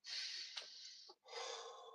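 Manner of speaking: long unvoiced breathing-exercise exhale; deep breath out. Shown as a demonstration. Demonstrating breath work to viewers, long exhale. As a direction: show the rapid deep breath. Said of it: "So you go".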